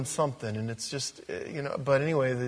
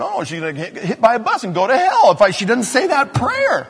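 mock panic; 6 semitones higher, 9 dB louder than usual. Mock alarm about missed prayer.

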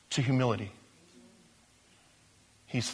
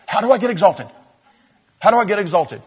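louder, higher, impatient to be exalted; eager mock demand; 6 semitones higher, 9 dB louder, faster than usual. A believer asking how to get exalted, eager and pushy. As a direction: play an over-keen glory seeker.

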